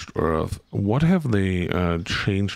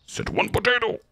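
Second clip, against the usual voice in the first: squeaky scold; faster than usual. Pretend. Scolding the shopping game.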